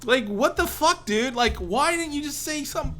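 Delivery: high-pitched, exasperated disbelief; retold incredulous protest. Roommate confronting the phantom shitter, incredulous protest. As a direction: pitch it up, demanding an explanation.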